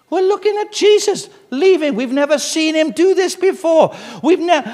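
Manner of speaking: high, put-on voice of puzzled disciples; voicing the baffled disciples high and fast; mock incredulous disciples. Preacher voicing disciples staring at the sky, high and incredulous.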